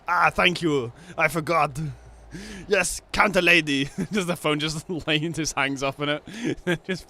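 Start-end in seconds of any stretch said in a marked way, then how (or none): 0.1-3.9 louder, higher put-on Arnold. Arnold thanks the DMV counter lady, loud put-on voice. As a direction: thank her like hammy Arnold. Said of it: "It's just".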